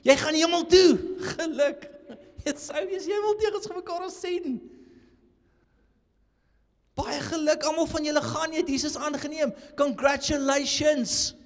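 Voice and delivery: mock cheery believer. Preacher mocks easy-salvation believers, chirpy high mock voice. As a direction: ham up the chirpy well-wisher.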